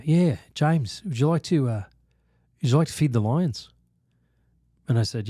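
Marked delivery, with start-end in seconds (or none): 0.0-3.7 put-on Australian accent. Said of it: "he was from Australia".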